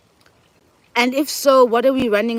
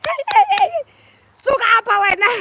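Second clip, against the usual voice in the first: much higher, louder shout chasing her off; guard yelling; 3 dB louder, faster than usual. Retold or imitated. Guard chasing her from the stage, shrill angry yell. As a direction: yell it to shoo someone away. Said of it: "They're like".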